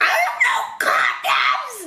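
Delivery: comic, squeaky panicked blurt; high-pitched mock squeal. Retelling a blurt to the lover after kissing, mock squeal. Blurting out in retold bedroom scene.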